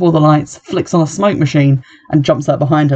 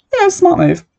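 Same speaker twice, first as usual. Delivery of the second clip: sarcastic. Real, not acted. Mock praise of fleeing.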